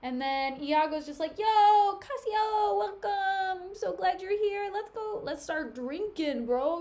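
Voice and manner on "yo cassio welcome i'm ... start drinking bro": bro Iago voice. Retold or imitated. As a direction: hype him up like a frat bro. Iago greets Cassio, dudebro voice.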